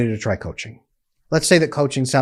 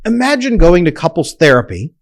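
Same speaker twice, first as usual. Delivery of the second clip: ordinary delivery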